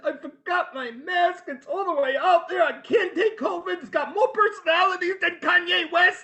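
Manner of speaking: high, hammed-up tearful wail; mock crying. Telling a stranger about the forgotten mask, mock crying. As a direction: wail the complaint like a comic meltdown. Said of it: "I started to cry".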